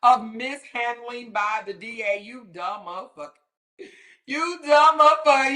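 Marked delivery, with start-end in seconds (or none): 2.2-3.3 muttered under the breath, softer; muttered insult. Real, not acted. Muttering at Donna before blowing up, softly. 4.3-5.6 "You dumb motherfucker." yelled high and loud, "dumb" stressed; exploding insult. Real, not acted. Yelling at Donna after reading the answer, high and loud.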